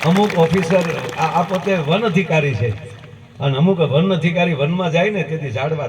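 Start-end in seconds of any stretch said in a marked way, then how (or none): none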